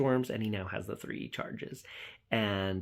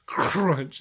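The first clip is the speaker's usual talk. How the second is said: a loud, punchy mock crunch; loud crunch effect; 5 semitones higher, 8 dB louder than usual. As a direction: smash the word like teeth biting down. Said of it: "went to town with the sound effects".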